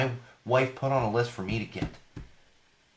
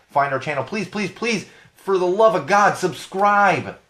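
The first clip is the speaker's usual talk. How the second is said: pleading; 7 semitones higher, 4 dB louder, slower than usual. Real, not acted. Begging listeners to subscribe.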